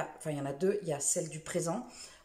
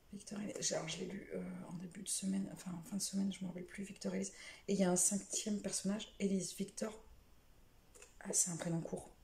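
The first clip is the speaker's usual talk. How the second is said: soft, under-the-breath recall; muttering to self; 8 dB quieter than usual. Real, not acted. Vlogger trying to remember the fifth character's name, muttered to herself. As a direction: think aloud quietly, running through names.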